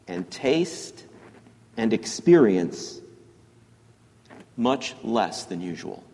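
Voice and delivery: slow, deliberate quote reading with long pauses. Real, not acted. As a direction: read it out slowly, phrase by phrase, letting each one settle. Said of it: "I'm gonna read this slowly".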